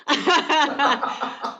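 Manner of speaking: amused, talk through real laughter; laughing. Real, not acted. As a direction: let real laughter bubble through the answer. Laughing after blunt answer no.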